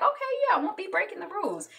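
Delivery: high, fast mock dad going along; a hint of a reluctant dad. Jack gives in to the kids, a mock high agreement. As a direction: play along with the kids, lightly.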